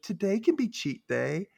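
high, slow wheedling plea; playful coaxing girlfriend voice; mock coaxing. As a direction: tease him into it, hammed up. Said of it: "She's like".

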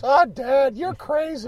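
put-on very high squeaky kid voice. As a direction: squeak it like a young boy. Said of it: "Did he say in squeaky voice".

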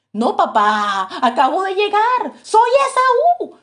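a son crying out that he is Esau, climbing in pitch; desperate protest. Acted. Esau protests to his father.

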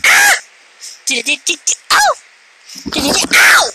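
sudden high yelps of pain; short, high "ow" cries. Real, not acted. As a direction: yelp as if just bitten by the cat. Pet cat bites the speaker.

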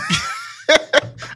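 high unvoiced burst of laughter; shrieking laugh. Real, not acted. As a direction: cackle out loud in disbelief. Shocked laughing outburst.

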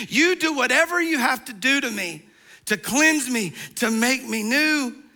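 preaching Peter's plea with rising force; fervent preacher's plea; impassioned plea. Acted. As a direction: beg to be made clean, preacher style.

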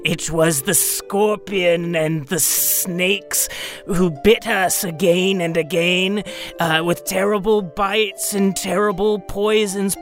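high, slow dwarf poet; hammed-up dwarf reciting verse. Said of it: "and then goes to the dwarf".

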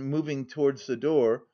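ordinary delivery, nothing marked

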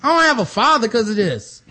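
high, loud offended protest; outraged, near shouting in disbelief; indignant outburst. Retold or imitated. Son snapping at the king's man over his dead father, high and loud. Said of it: "he was like".

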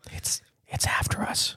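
frightened whisper; hushed, scared whisper; urgent resistance whisper. Pretend. Mock fear of a Bigfoot.

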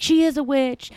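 put-on high-pitched kooky old neighbor; mock accusation. Kooky lady accuses new neighbor.

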